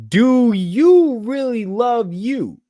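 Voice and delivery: "do you really love you" stretched slowly, high and loud; drawn-out emphatic question. Shown as a demonstration. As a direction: hold each word of "do you really love you", pitch soaring, falling on the last "you". Pointed rhetorical question to listeners.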